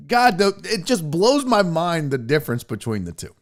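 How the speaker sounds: normal talking voice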